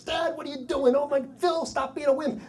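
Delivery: high-pitched mock yelling of kids; imitated kids yelling. Kids yelling at dad and scared friend, imitated. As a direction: complain high, like squabbling children. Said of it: "They start yelling".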